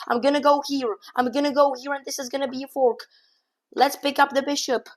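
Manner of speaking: high, loud, racing calls as the clock runs out; frantic excitement. Real, not acted. Streamer racing the opponent's last seconds, frantically excited. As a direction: shout every move as seconds tick away. Time scramble, flagging the opponent.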